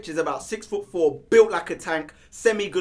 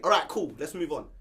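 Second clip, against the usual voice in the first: ordinary talk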